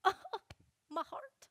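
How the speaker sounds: high, loud melodramatic wail; hammed-up heartbreak. Daughter mocking her own guilt after mom's scolding, hammed-up melodrama. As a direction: ham it up like a tragic heroine. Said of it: "I'm like".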